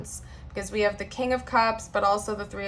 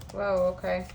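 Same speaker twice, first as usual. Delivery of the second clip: ordinary talk, nothing marked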